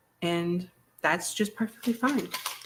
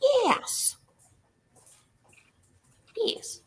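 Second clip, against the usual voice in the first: squeaky high yes; much slower than usual. Acted.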